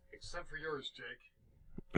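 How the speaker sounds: hushed whisper. Real, not acted. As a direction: whisper it off mic. Turns mics off, whispers.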